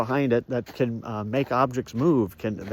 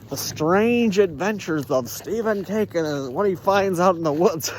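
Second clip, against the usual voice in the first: trailer voice; 5 semitones higher than usual. Pretend. Announcer-style title.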